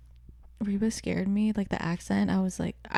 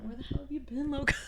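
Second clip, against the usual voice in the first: soft, higher-pitched movie-quote impression; hushed, playful character line; Jacob; 6 semitones higher, 12 dB quieter, faster than usual. Fan quoting the character to co-host, soft mock impression. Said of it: "just Jacob".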